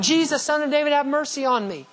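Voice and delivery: high, pleading cry of a blind beggar; crying out for mercy. Pretend. Blind Bartimaeus crying out to Jesus, high pleading call. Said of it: "would have cried out".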